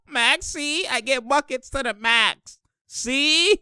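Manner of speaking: high mock catchphrase, stretched at the end; playful high-pitched brag; Maxey. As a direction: imitate a player's boast in a high voice. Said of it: "Maxey said".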